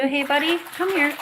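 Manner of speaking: high, sing-song call to the bird; pet talk. Real, not acted. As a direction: coax the pet over in a high voice.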